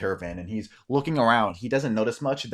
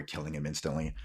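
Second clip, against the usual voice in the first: ordinary talk, nothing marked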